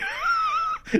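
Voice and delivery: a sudden high burst of laughter; amused laugh. Real, not acted. Instructor laughing at the filtered model's stare. Reacting to startled portrait face.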